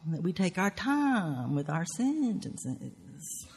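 stretched-out, exaggerated Southern drawl; half-speed demonstration of a drawl; slow Southern drawl. Shown as a demonstration. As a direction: take your time, dragging out each key word. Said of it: "Southerners talk more slowly".

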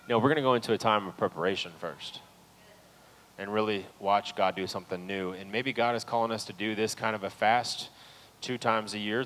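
normal talking voice

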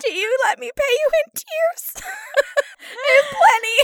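very high tearful squeal; fake-sob it in a squeaky high voice; mock crying. Passenger offering tears as a tip, mock crying.

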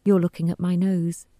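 ordinary delivery

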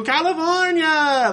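very high, slow drawn-out call; Olive Oyl call. Retold or imitated. Olive Oyl calling out to the family across the park, high and slow. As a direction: stretch it out in a high cartoon voice. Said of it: "like that".

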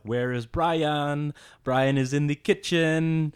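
put-on slow, high French-accented English. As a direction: mimic French girls saying Ryan. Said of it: "B instead of R".

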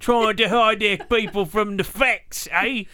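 slow, high, broad fake British; mock Cockney accent. Agent accusing them of hiding facts, high mock Cockney. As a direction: drawl it in overdone Cockney.

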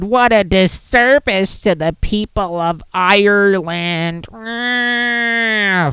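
slow, hammed-up obnoxious complainer; cartoonish villainous scolding, words stretched out; shitty person. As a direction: rant slowly in a badly faked, put-on sour voice. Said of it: "this is gonna be a shitty person".

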